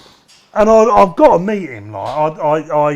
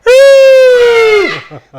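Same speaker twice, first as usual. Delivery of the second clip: high shrieking laugh; loud real laughter; big loud laugh; 10 dB louder than usual. Real, not acted. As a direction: burst out laughing hard. Laughing at his own story.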